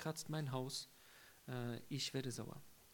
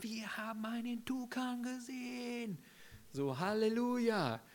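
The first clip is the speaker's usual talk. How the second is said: much higher, thrilled cry over the toucan; high, far-off-sounding delighted exclamation; excited joy; 9 semitones higher than usual. Retold or imitated. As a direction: burst out in delight at spotting it. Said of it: "dann war das so".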